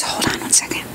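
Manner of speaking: breathy aside whisper. Real, not acted. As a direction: murmur it aside under your breath.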